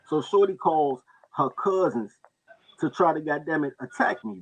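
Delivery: usual speaking voice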